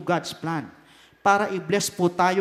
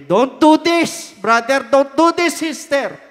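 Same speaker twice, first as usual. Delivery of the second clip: pleading warning; 4 semitones higher, 8 dB louder, slower than usual. Acted. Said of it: "pag siya naman nagsabi".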